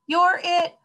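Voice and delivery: high, loud playground cry; howled taunt. A narrator's reading. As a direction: howl it in the game of tag. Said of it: "howled a haddock".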